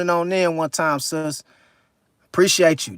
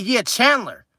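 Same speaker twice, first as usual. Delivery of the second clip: lightly voiced girlfriend. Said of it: "She said".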